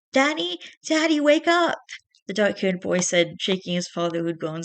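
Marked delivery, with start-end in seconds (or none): 0.1-1.8 put-on high, urgent child's plea; frightened little boy calling, pitch raised; alarmed child. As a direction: call out in panic to rouse your father. Child shakes his unresponsive father.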